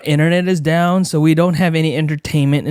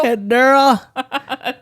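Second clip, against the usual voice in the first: much higher and very slow, sing-song Daryl with a rising end; silly high voice; 10 semitones higher, much slower than usual. Pretend. Husband teasing his wife, mock high drawn-out voice. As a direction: stretch it out high and goofy.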